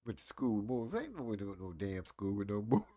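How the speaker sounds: a hint of an offended old man; old man role. Playing the old man the chat teased, grumbling low. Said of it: "I'ma go and play the role then".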